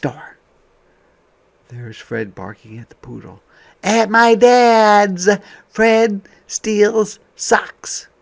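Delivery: put-on reading to children. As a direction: savor each phrase for young listeners. Reading picture book aloud.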